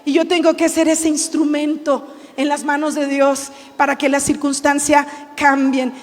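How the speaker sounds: usual speaking voice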